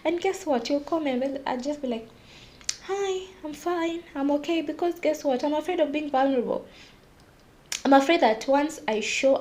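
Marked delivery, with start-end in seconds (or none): 2.8-4.6 pretending to be fine. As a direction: cover it up with a sweet, quiet greeting.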